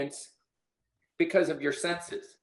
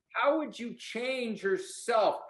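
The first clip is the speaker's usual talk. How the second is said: usual speaking voice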